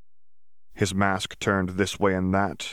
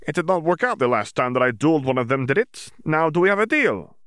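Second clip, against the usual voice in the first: higher, louder, pushing for an answer; defiant wizard demanding a deal, voice raised; Yaxley pressing; 8 semitones higher, 4 dB louder than usual. Acted. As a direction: corner him into agreeing.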